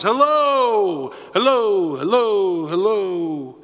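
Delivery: calling out into the mountains, fading like an echo; shouting hello. A narrator's reading. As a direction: shout it up, then let it die away. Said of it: "he'd shout into the mountains".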